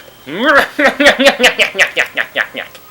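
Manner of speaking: high loud cackle; loud laugh. Real, not acted. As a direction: burst out laughing. Laugh at end of episode.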